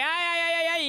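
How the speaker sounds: high run of "ay" yelps; demonstrated game cries. Shown as a demonstration. Showing her friend how she gets past, high cries. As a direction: show how you yell through the level. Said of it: "yo paso así como que".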